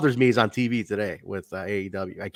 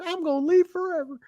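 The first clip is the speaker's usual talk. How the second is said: very high-pitched whiny imitation; mock dramatic vow. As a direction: squeal it like a hammed-up threat to quit. Acts out wrestler's dramatic vow.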